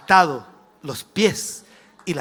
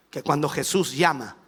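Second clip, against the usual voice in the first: ordinary talk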